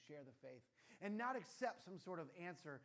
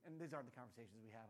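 ordinary delivery

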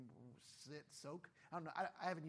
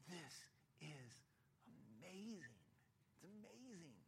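awed hush; 11 dB quieter, slower than usual. Acted. Crowd awed.

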